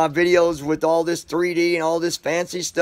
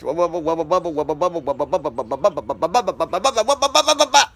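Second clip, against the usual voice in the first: rattling off "Wubble Bubble" fast until it falls apart; fast tongue twister; 2 semitones higher, faster than usual. Shown as a demonstration. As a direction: race through the tongue twister and trip up. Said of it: "Say that five times real fast".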